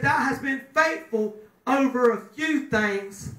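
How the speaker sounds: usual speaking voice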